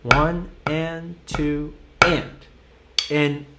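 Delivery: "One, and two, and" drawn out very slowly; very slow count. Shown as a demonstration. Teacher slowing the count way down for a student. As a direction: stretch every count out. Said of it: "let's just go a little bit slower".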